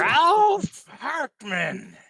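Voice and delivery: put-on slow, high, loud old man cry. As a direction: drag the name out like an old man. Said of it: "in the old man voice".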